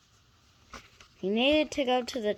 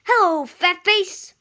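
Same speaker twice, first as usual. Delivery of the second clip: higher, louder teasing call; cheeky engine; 9 semitones higher, 12 dB louder than usual. A narrator's reading. Henry teasing Gordon as he passes, high and loud. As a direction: tease him cheerfully from afar. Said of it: "whistled Henry".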